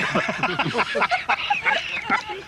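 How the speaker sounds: high, long giggling laugh. Real, not acted. Laughing about hiding behind the canteen door. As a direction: dissolve into giggles.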